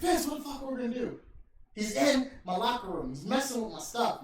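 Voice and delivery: hammed-up Austin freaking out over his locker room; mock panicked rant. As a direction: rant like a furious wrestler. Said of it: "Austin's fucking going ape shit".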